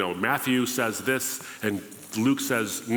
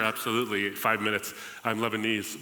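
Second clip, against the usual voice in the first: ordinary talk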